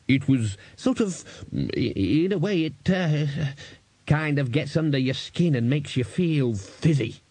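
slow, groping description with hesitations; halting, drawn-out wonder; entranced Dean. A narrator's reading. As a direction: feel for the words to describe it.

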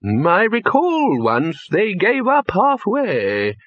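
put-on slow, high old man's voice, delighted; old Poons relishing the memory; high, slow and gleeful; happy old wizard. A narrator's reading. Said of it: "said Poons happily".

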